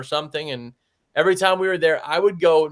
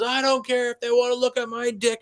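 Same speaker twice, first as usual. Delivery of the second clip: put-on high cranky old man yell; old man shouting down from the stairs; old man retort; 9 semitones higher than usual. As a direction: bark back like a stubborn old man.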